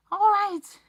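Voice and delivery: loud high shout; shouting to stop the elf; cry out. Acted. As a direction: yell to stop him. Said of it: "cried Harry".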